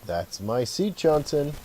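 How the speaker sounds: mock-authority ribbing with a lifted finish; playful officer. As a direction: playfully claim the seat, voice rising at the end. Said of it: "playfully says".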